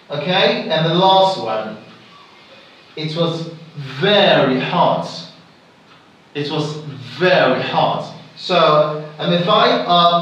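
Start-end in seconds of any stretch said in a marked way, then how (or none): 3.0-5.1 slow line with a weighty "very"; stress on very. Shown as a demonstration. Teacher giving last example, emphatic stress on "very". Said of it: "the word very here". 6.3-8.1 "very" set out as the main beat; stress on very. Shown as a demonstration. Teacher repeating last example, emphatic stress on "very". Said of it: "the word very here".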